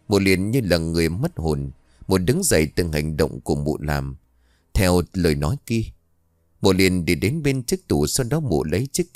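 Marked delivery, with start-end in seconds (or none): none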